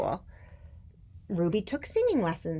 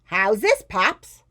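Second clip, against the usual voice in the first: blaring, higher-pitched question; loud child; 4 semitones higher, 10 dB louder, slower than usual. A narrator's reading. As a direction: belt out the question to Dad. Loud Ruby checks with father.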